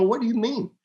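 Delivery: jumps way up in pitch, totally confused; baffled question. Retold or imitated. Husband baffled as his wife insists, pitched high. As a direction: sound completely thrown by the claim. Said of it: "I go".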